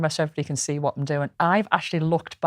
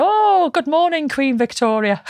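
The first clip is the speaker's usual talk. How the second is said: high, slow, cheery greeting; security guard's greeting; 8 semitones higher, slower than usual. Retold or imitated. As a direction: sing out the greeting like a friendly guard. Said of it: "And he'd go".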